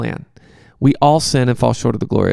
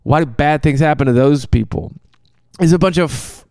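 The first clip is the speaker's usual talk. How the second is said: ordinary talk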